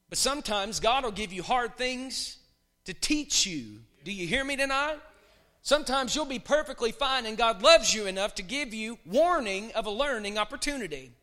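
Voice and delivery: loud, rising preacher's cry; loud fervent preaching; preaching at full force. Acted. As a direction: build the sermon to a shout.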